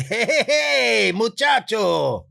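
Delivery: Juan warm-up line. Pretend. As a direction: hail a friend across the street. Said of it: "gets me back into the way that character sounds".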